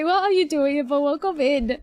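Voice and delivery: normal talking voice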